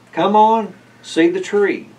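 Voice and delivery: high, loud eager call; children calling the grown-ups to the tree, high and loud. Acted. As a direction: call it out like excited kids. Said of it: "the children called".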